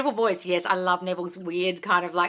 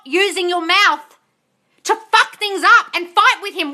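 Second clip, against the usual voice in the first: high, loud, heated lecture; exasperated telling-off; 11 semitones higher, 8 dB louder than usual. Real, not acted. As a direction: lay into them, fed up. Coach scolds client, fed up.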